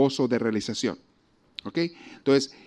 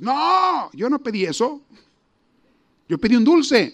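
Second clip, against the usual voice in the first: put-on whiny child; 9 semitones higher, 3 dB louder than usual. Child protests to mother.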